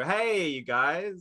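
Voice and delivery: high, slow hey you guys; mock fan calling the show's name; imitated fans' greeting. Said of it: "maybe people go".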